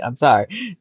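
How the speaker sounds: ordinary delivery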